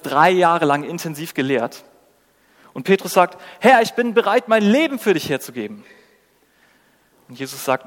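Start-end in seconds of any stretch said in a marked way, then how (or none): 3.6-5.8 higher, louder earnest pledge; fervent vow. Acted. Peter pledging his life to Jesus, fervent vow. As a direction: declare it with raised, eager devotion.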